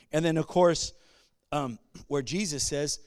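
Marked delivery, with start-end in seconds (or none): none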